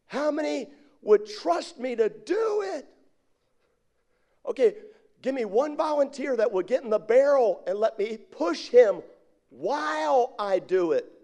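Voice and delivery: high, stretched-out call to a crowd; acted daredevil calling out for takers; showman's challenge.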